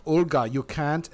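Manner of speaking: loud, slow, high mock German accent. Shown as a demonstration. Player showing her German accent that sounds wrong, German accent. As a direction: put on an exaggerated German accent. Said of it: "if I did my German accent".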